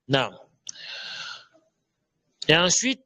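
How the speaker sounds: ordinary talk, nothing marked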